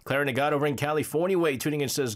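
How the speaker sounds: ordinary delivery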